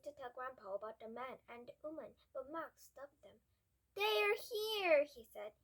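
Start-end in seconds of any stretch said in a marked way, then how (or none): 4.0-5.0 urgent. A narrator's reading. Warning Grandpa of the thieves.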